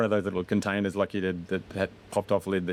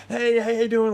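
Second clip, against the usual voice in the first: rowdy passerby; 6 dB louder than usual. Retold or imitated. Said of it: "running up in front of us going".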